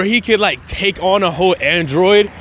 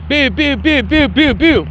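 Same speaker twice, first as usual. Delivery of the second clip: pew blast noises; 6 semitones higher than usual. Retold or imitated. Imitating ki blasts in fight.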